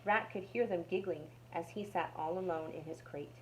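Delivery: ordinary delivery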